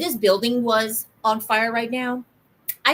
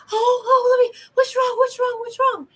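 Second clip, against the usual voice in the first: much higher, louder hammed-up frantic fretting; mock panicked parent; 12 semitones higher, 4 dB louder than usual. Mother imitating a panicking parent at a hurt child, hammed-up frantic cry. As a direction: overplay the panicked "what's wrong" with a crying edge. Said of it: "is to be like".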